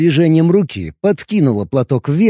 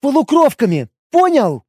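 higher, louder exasperated elf; raised voice; 12 semitones higher, 4 dB louder than usual. Acted. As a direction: raise your voice at him. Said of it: "повысила голос".

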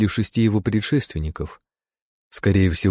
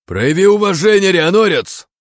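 furious hissed shout, much higher; whisper yelling; 12 semitones higher, 7 dB louder than usual. Acted. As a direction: spit it out in rage, loud. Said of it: "вдруг яростно прошипел Киданин".

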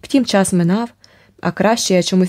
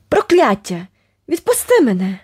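screaming; 5 semitones higher than usual. A narrator's reading. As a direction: fight back at him with a shout. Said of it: "продовжувала щосили кричати". (гнівний крик, вищий і гучніший)

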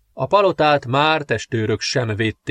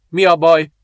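loud, higher, pained question; gasping in pain; 5 semitones higher, 6 dB louder than usual. A narrator's reading. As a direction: demand it through labour pain. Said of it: "zihálva fájdalmában". (fájdalmában zihálva)

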